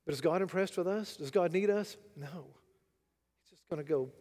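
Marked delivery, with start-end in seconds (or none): none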